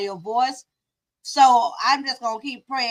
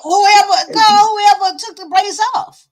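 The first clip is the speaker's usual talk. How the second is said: loud and higher, cutting in; loud insistence; 6 semitones higher, 9 dB louder, slower than usual. Real, not acted. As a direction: shout it to be heard over her. Insisting over the other speaker.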